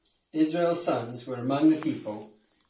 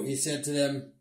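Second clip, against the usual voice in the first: usual speaking voice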